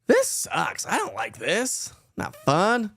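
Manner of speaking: high whiny mock complaint; lightly whined mock grumble; mock whining. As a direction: whine it like a fed-up parent. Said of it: "looking at it going".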